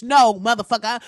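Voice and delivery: ordinary talk, nothing marked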